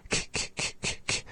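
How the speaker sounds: whispered, breathy k, k, k, no vowel; soft unvoiced k repeated; isolated k sound. Shown as a demonstration. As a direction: tap out a bare voiceless k again and again. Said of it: "to get used to that isolated k sound".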